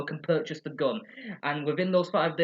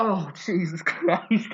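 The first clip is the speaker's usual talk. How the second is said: laughing disbelief; 3 semitones higher, 4 dB louder, much slower than usual. Real, not acted. Viewer reacts laughing.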